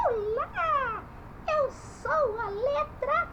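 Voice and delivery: high-pitched puppet greeting; drawn-out, high little letter voice; puppet letter character. Pretend. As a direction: introduce yourself as a high-voiced cartoon letter. Puppet letter A introduces itself.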